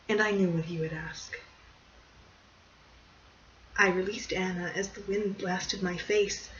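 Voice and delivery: plain reading voice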